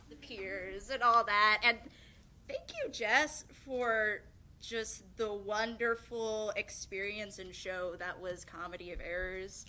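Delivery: ordinary talk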